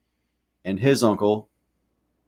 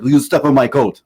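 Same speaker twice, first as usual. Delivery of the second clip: mock Frenchman, louder French-accented protest; 3 semitones higher, 7 dB louder than usual. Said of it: "the Frenchman was like".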